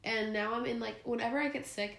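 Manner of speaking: ordinary delivery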